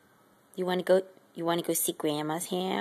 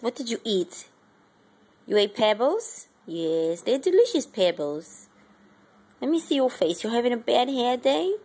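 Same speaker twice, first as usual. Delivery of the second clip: ordinary delivery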